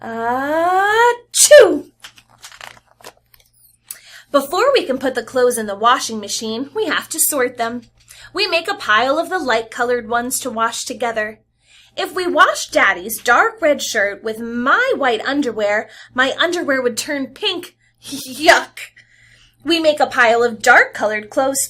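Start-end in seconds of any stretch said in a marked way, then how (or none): 0.0-1.9 loud playful pretend sneeze rising high; a big silly fake sneeze; mock sneeze. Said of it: "Let's sneeze with him". 18.0-18.8 mock disgust. Pink underwear disgust.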